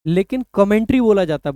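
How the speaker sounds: ordinary talk, nothing marked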